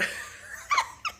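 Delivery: high, squeaky fit of laughing; helpless laughter. Real, not acted. Teller breaking up over homie's confession, helpless high laugh. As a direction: crack up before the punchline. Cannot get punchline out.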